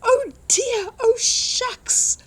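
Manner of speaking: small mole seeing the trucks; dismayed Mole. Acted. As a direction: gasp it out in shock as the little mole.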